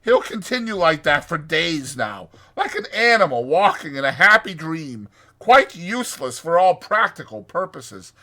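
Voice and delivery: high, emphatic know-it-all Rat; firm. A narrator's reading. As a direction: explain it loudly, brooking no argument. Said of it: "replied the Rat firmly".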